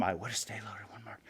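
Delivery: whispered, fading out. Real, not acted. Recovering addict confessing, trails into a whisper. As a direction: let it drop to a breathy whisper.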